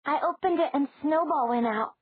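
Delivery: put-on little girl. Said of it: "Margaret said".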